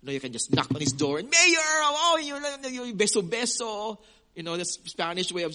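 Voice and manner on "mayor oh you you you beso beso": high, gushing mock sweetness to the mayor; butter up the mayor in a high sing-song; fawning greeting. A churchgoer mimics someone fawning over the mayor.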